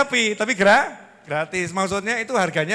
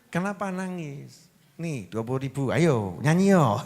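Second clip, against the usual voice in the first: tender, unhurried cajoling; comforting a child; 6 semitones lower, 5 dB quieter than usual. Acted. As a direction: soothe the crying child gently. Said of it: "Kita hibur tuh yang, yang nangis".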